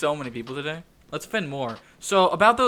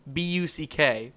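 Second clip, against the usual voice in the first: ordinary talk, nothing marked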